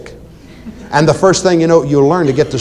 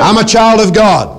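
louder, higher bold declaration; 6 semitones higher, 4 dB louder than usual. Shown as a demonstration. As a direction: declare it boldly to the congregation. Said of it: "Say it out loud, please, boldly".

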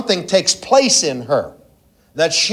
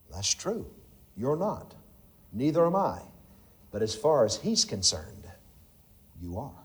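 much softer, gentle aside; hushed reassurance; 5 semitones lower, 13 dB quieter than usual. Real, not acted. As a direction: draw them in quietly. Hushed intimate reassurance to listeners.

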